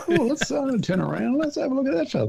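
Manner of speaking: high, eager exclaim; excited spotting. Retold or imitated. Mate in the car spotting a good-looking animal, high and eager. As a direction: squeal with delight at the find.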